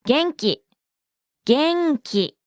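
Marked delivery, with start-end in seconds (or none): 1.4-2.4 元気 drawn out in two separate beats; slow model. Shown as a demonstration. Teacher breaks down the word for fine to learners, slowly. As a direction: say 元気 slowly, beat by beat.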